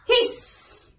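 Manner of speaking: soft hiss with long trailing s; teacher's gentle snake hiss; snake sound. Shown as a demonstration. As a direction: stretch the s like a snake. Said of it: "the sounds of animals".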